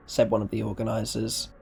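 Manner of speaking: usual speaking voice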